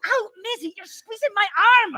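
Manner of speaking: pained protest. Acted. Friend gripped by frightened Maisie, high pained protest. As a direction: complain as if squeezed hard.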